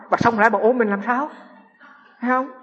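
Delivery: ordinary delivery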